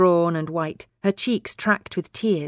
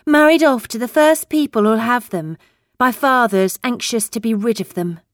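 prim schoolmarm; 5 semitones higher, 4 dB louder than usual. Acted. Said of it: "Her voice was like a schoolmarm's".

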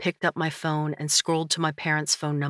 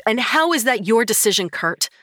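louder, higher through-the-teeth retort; through gritted teeth; 6 semitones higher, 5 dB louder than usual. Acted. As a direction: hold the anger back. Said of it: "I asked through my teeth".

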